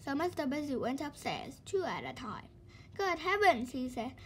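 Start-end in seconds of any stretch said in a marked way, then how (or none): 3.0-3.7 high, loud good heavens; surprised. Acted. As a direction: exclaim in mock shock. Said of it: "surprised at Mister Slow in bed".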